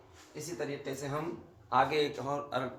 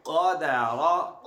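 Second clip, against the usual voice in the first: क़दर stretched very slowly, rising at the end; slow drawn-out reading; 4 dB louder, much slower than usual. Shown as a demonstration. Teacher slowly modelling the joined word. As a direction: draw out क़दर syllable by syllable. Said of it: "join कीजिए".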